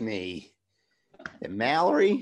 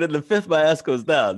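louder, retold line; louder retelling of his line; 5 semitones higher, 7 dB louder than usual. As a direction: say it louder, still half in character. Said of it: "I said".

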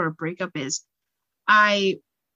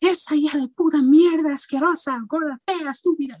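high, loud insults spat out like an angry mom; hammed-up angry mom ranting in Spanish; mock angry Latin mom; 8 semitones higher, 4 dB louder than usual. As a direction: madre enojada, insultando en voz alta y aguda. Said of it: "every Latin mom is just like".

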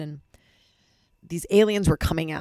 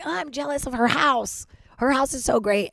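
envious whine; 8 semitones higher than usual. Retold or imitated. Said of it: "myself being like".

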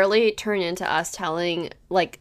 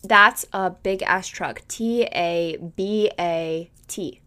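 slow decoding read; 4 semitones lower, 4 dB quieter, much slower than usual. Shown as a demonstration. Podcaster decodes the biker's phrase for her cohost, slow, deliberate reading. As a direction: read "That's a big-ass truck." slowly and evenly, weighing each first letter. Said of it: "the beginning letters of each word".

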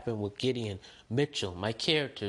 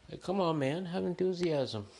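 higher, pleading outburst; exasperated plea; 7 semitones higher than usual. Real, not acted. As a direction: come on, beg him to liven up. Urging YouTuber to have enthusiasm.

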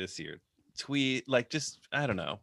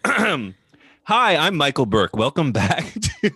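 host voice; 4 semitones higher, 4 dB louder, slower than usual. Shown as a demonstration. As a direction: show host's welcome, projected and lifted. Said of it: "this isn't my host voice".

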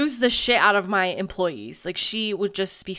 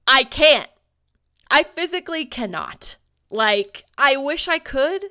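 exasperated; 6 semitones higher, 6 dB louder than usual. Real, not acted. Exasperated retail manager ranting.

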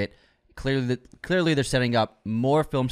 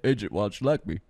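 Rocky impression; 2 semitones lower than usual. Rocky impression about Adrian.

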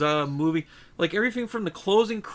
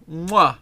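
chef's kiss smack; 6 dB louder than usual. Acted.

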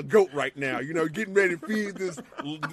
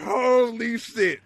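slow stunned amazement; 5 semitones higher, 5 dB louder, much slower than usual. Retold or imitated. His reaction as show ends.

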